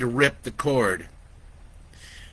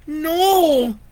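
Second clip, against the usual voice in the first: put-on high, loud child's cry of no; a scared kid begging her sister not to go; pleading; 7 dB louder than usual. Frightened girl pleading, high and loud. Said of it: "Lori pleaded with her".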